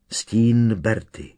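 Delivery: plain reading voice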